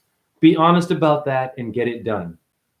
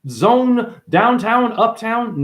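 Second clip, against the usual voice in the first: high, louder goofy voice; mock talking area; 9 semitones higher, 4 dB louder, slower than usual. Voicing an area that talks, high and goofy. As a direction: play a chatty place sign. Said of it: "An area doesn't say".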